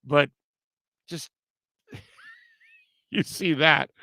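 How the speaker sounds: ordinary talk, nothing marked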